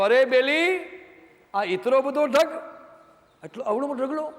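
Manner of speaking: a Kutchi Sandhi gawking at a grain heap; Kutchi Sandhi dialect. Retold or imitated. The Sandhi amazed at the wheat heap, in Kutchi dialect. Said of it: "સંધિ એની ભાષામાં કીધું".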